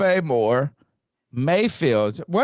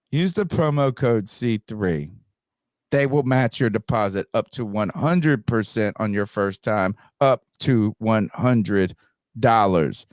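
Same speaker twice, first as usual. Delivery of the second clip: lower, steady sponsor read; 4 semitones lower than usual. Acted. Host reading the sponsor promo to listeners, ad voice. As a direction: slide into the promo pitch.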